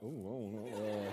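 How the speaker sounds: low, slow, soft mumbled uncertainty; unsure students. Retold or imitated. Students asked for their hand signal, mock unsure murmur. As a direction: imitate a clueless crowd, slow and low. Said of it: "And they're like".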